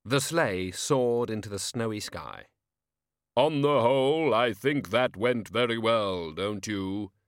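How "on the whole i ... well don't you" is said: slow grave voice of Death, cheerfully pleased. Acted. Death chatting to Albert after deliveries, calm and grave. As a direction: speak as Death, mild satisfaction.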